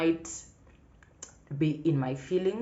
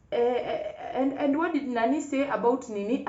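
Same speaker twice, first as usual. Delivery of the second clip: imitating mom, much higher and chatty; mom's sudden chat; 10 semitones higher, 3 dB louder than usual. Mom chatting right after scolding, imitated mom voice. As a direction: switch to mom's bright gossip after scolding. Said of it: "And then the next minute".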